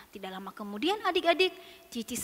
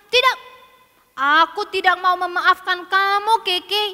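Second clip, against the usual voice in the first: high, loud, stretched-out sulk; angry refusal; 4 semitones higher, 11 dB louder than usual. Acted. Sister refusing to forgive Keke, loud and cross. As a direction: refuse crossly, like a wronged child.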